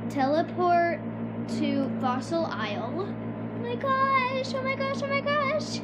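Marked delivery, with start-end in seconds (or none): 3.1-5.8 freaking out. Real, not acted. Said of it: "I am freaking out".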